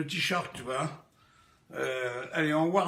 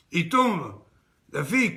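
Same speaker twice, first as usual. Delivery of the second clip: ordinary talk, nothing marked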